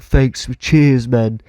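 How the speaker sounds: imitating a British stranger's thanks; chummy British bloke; casual put-on British accent. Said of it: "actual British people".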